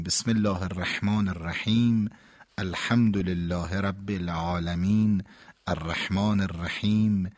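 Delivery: "بسم الله الرحمن الرحیم" recited slowly, every word and letter separated; careful, measured Quranic recitation, each sound distinct; slow clear recitation. Shown as a demonstration. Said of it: "باید نماز رو هم ما همینطوری بخونیم". (تلاوت شمرده و واضح، هر کلمه جدا)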